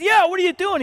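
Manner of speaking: high-pitched mock indignation; outraged neighbor lady. Neighbor lady grilling Gary about the tree, high indignant voice. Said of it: "She said".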